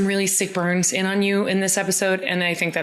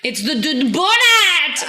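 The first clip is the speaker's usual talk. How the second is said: loud, slow, hammed-up Scottish brogue; thick Scottish accent; 8 semitones higher, 5 dB louder, slower than usual. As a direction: belt it in a terrible Scottish accent. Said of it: "incredibly thick and really terrible accent".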